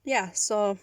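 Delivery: ordinary talk, nothing marked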